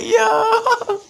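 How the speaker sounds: high, loud laughing yelp of disbelief; half-laughing, half-shouting in shock. Retold or imitated. Shocked over the walkie-talkie call.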